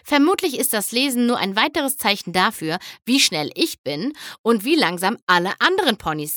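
ordinary delivery

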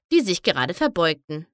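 plain reading voice

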